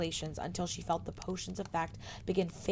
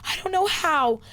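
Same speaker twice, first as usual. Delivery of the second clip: high, loud, breathless crying; a girl too upset to breathe; choked sobbing; 9 semitones higher, 7 dB louder than usual. Acted. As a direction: push the words out between sobs. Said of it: "struggled to breathe".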